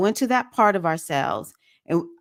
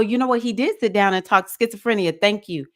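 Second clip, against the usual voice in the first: normal talking voice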